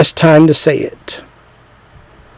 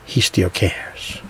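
whispered aside; 10 semitones lower, 14 dB quieter, much slower than usual. Real, not acted. Preacher confides to the congregation, whispered. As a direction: whisper it, secret tender truth.

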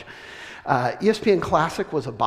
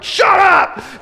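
much higher, loud, hammed-up snap at the baby; mock shout; 10 dB louder than usual. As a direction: yell it in comic frustration. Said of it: "I'm like".

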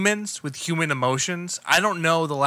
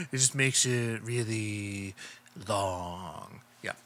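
a lower, slow, softer croak; cocaine-wrecked voice; 5 semitones lower, 7 dB quieter, slower than usual. Pretend. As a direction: drag the words out like a ruined throat. Mimicking cocaine-ruined throat voice.

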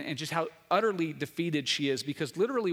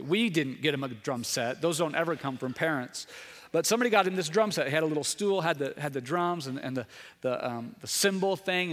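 ordinary talk

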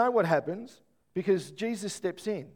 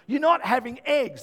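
higher and louder, firm refusal; dad shutting down the egg request; stern dad; 6 semitones higher, 6 dB louder than usual. Retold or imitated. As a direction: lay down the law to the kids. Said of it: "that's my response".

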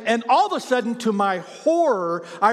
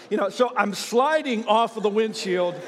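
ordinary delivery

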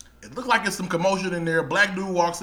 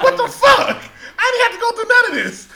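much higher, laughing exclamation; laughing disbelief; 4 dB louder than usual. Real, not acted. Laughing at the sour slushie, high laughing outburst. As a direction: crack up at your own mistake.

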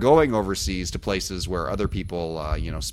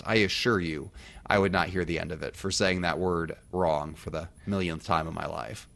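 ordinary talk, nothing marked

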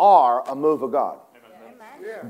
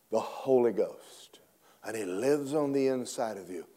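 whisper; 4 semitones lower, 9 dB quieter than usual. Acted. Preacher drops to whisper.

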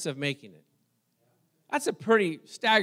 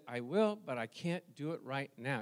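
reluctant murmur; 5 semitones lower, 10 dB quieter than usual. Retold or imitated. Quiet reluctant reply to the Lord.

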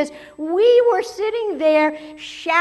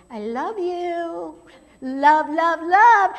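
sending love; 3 semitones higher, slower than usual. Retold or imitated. Heidi sends the cranky waitress love.

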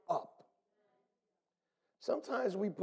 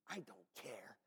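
whispered aside; 11 semitones lower, 22 dB quieter than usual. Retold or imitated. Himself, aside.

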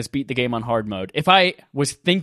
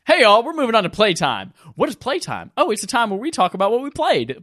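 put-on Southern accent; 8 semitones higher than usual. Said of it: "I slip into some kind of accent".

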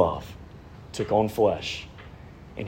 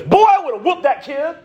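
much higher mock outraged father; angry dad. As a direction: blurt it as a riled-up father. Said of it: "he goes".

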